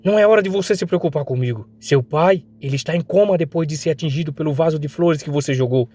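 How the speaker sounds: acted girl's reproach; angry scolding. Girl berates him about his father.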